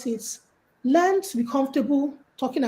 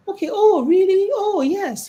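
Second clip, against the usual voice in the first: higher, louder eager responses; interested listener; 7 semitones higher, 3 dB louder than usual. Shown as a demonstration. Host showing guests how to listen, eagerly interested. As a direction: show keen interest as you listen. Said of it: "you know, listen more".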